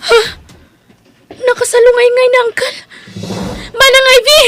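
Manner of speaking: high, loud panicked cry for help; panicked scream. Acted. Edna finds her uncle collapsed, screaming for Ivy in panic. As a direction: shriek for her cousin in alarm.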